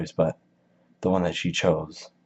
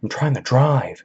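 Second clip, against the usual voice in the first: much higher, louder snapping shout; yelling parent; 8 semitones higher, 7 dB louder than usual. Retold or imitated. Parent driving, yelling at chatty kids, loud shout. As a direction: blow up at the kids from the driver's seat. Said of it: "your parents in the car, they're just like".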